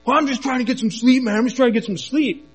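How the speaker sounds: high-pitched, whiny protest; imitated yelling. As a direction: imitate an irate stranger hollering back. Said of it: "he starts yelling at us".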